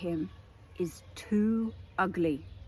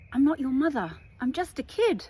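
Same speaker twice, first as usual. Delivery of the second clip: put-on high, louder child-like protest; quoted child's protest; 6 semitones higher, 5 dB louder than usual. Queen of Cups quoting a film kid, mild protest. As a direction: lightly act a kid pushing back.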